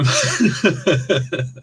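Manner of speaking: high, breathless laugh breaking through; can't hold the laugh in any longer; burst of laughter. Real, not acted. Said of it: "I'm trying so hard not to laugh".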